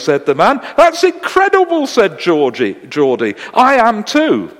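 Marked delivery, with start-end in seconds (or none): 0.8-1.9 high, loud astonishment; amazed cry, pitched way up. Acted. Geordie amazed at shared faith. 3.5-4.6 high, loud, drawn-out delight; hammed-up excitement, stretched out; thrilled. Geordie thrilled at the match.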